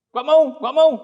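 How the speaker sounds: put-on high, loud childish no; stubborn refusal. Bagong refusing Romo's call, high and loud. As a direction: shout it like a stubborn kid.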